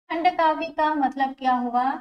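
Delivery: ordinary delivery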